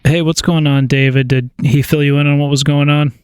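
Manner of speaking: normal talking voice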